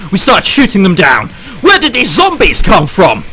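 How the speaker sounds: ordinary talk, nothing marked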